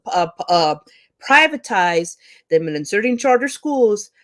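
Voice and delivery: ordinary talk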